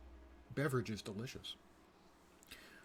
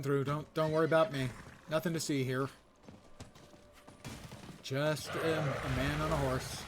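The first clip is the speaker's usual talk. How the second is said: playful taunting; 3 semitones higher, 4 dB louder than usual. Pretend. Riding a horse through demons.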